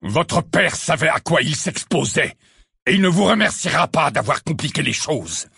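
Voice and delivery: high, loud, slowed fury; furious. A narrator's reading. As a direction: hammer each word. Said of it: "tout aussi furieux".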